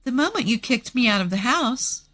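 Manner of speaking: plain reading voice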